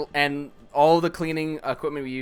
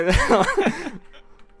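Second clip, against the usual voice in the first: high laugh; 10 dB louder than usual. Real, not acted. Laughing at his story.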